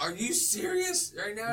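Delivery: mock disbelief. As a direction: act out being fed up, stretching it slowly. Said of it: "He's like".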